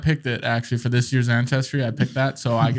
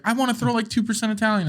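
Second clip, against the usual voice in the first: pitched up playful voice; high mock voice; 9 semitones higher than usual. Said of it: "you'd be like".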